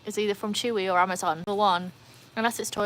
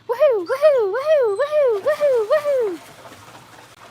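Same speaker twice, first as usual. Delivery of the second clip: very high, loud string of whoops; celebratory cheer; 10 dB louder, much slower than usual. Pretend. Streamer cheering a viewer's level-up, high celebratory whooping.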